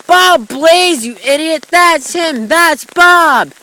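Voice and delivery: high, loud exasperated yell; shouting "you idiot" at the video; angry yelling. Real, not acted. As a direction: yell at the screen in frustration.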